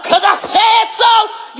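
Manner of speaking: high, drawn-out declaration; yelling. Real, not acted. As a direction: yell it slowly, every word stretched. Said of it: "me yelling".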